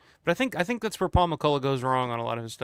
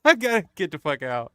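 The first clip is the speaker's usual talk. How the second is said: loud, impatient to be gone; dismissive, eager to leave; 3 semitones higher, 7 dB louder than usual. Retold or imitated. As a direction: brush it off and bolt. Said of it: "Russo's like".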